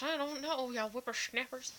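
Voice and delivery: put-on high, loud old lady voice. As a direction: play a cranky old granny. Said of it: "Put on my old lady voice".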